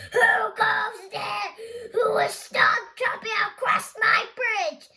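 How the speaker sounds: a mean troll roaring from below. Acted. Troll roars at the big goat crossing his bridge. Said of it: "troll roared".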